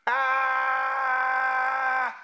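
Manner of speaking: loud, sustained monotone "aaah"; drowning-out yell. Acted. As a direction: block out the words with a long held "aah". Acts out someone refusing to listen.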